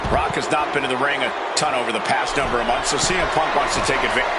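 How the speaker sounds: ordinary talk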